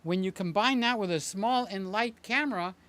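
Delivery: ordinary talk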